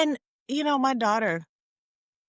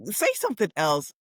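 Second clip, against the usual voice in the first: normal talking voice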